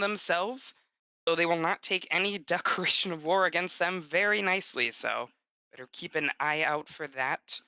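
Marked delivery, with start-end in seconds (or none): none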